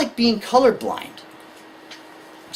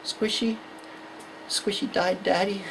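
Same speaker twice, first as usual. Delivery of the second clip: soft, halting little voice; mournful child; 9 dB quieter than usual. Acted. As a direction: quietly break the sad news. Son's sad announcement, acted.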